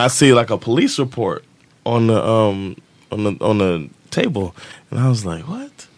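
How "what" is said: high, hushed double-take; a soft, high, incredulous gasp of a word; retold disbelief. Retold shocked reaction to police report.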